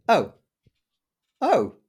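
high, loud dawning realisation; starstruck shock. Retold or imitated. Fan spots the artist's name on a lanyard, starstruck shock. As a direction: let each exclamation jump up in astonishment. Retold realisation of standing by celebrity.

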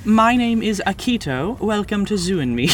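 lightly put-on Austrian accent. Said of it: "are we doing the Austrian accent?".

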